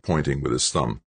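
ordinary delivery

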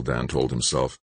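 usual speaking voice

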